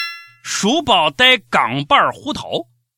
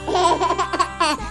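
high giggle; 10 semitones higher than usual. Real, not acted. Laugh after joke.

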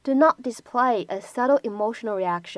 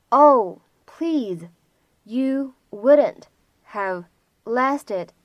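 each chunk said slowly with pauses; slow phrase-by-phrase read; 3 dB louder, much slower than usual. Shown as a demonstration. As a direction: break it into slow chunks, pausing between. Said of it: "需要注意的发音技巧". (逐词放慢、停顿清晰地示范)

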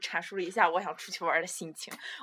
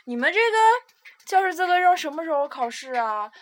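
questioning mother; 9 semitones higher, 8 dB louder than usual. Retold or imitated. Mother checking on exam date, high questioning voice. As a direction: imitate mum checking up, pitch raised. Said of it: "我妈就问我".

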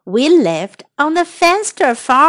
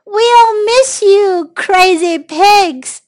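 high, loud, slow storybook wail; crying out; 6 semitones higher, 5 dB louder, slower than usual. A narrator's reading. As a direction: call out a drawn-out farewell. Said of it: "All the animals cried".

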